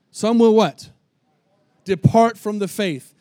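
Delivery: loud, slow preached verse with call to the congregation; preaching scripture. Acted. Pastor preaching First Timothy to the congregation, loud and slow. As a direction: drive the verse home to the crowd.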